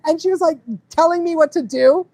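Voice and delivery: ordinary delivery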